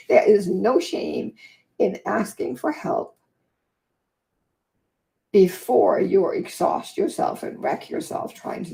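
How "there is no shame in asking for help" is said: there is no shame said slowly and firmly, a bit louder; slow emphatic stress. Shown as a demonstration. Urging viewers, she slowly stresses "there is no shame". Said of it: "here, even more".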